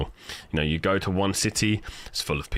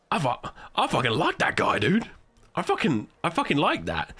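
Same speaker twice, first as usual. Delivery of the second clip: enthusiastic; 10 semitones higher than usual. Retold or imitated. Imitating own enthusiastic reaction.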